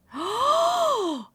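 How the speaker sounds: big, high, loud gasp; delighted gasp. Real, not acted. Streamer spots a gift from chat, loud surprised gasp.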